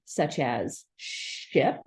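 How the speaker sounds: "such as ship" drawn out slowly; stretched example word. Shown as a demonstration. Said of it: "can come at the beginning".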